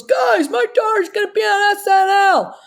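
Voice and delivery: very high, hollered announcement; proud dad shouting. Retold or imitated. Father bragging to golf buddies about daughter, high excited shout. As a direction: holler the big news to the whole group.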